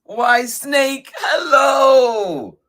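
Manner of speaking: high, loud mouse hello; calling out greeting. Acted. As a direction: sing out a big drawn-out hello. Mouse calls a greeting to Snake.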